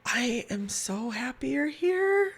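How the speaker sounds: put-on high, slow, delighted old woman; gently overjoyed to see her nurse; frail patient voice. Dying patient greets her nurse.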